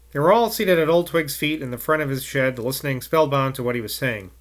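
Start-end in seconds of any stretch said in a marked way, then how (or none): none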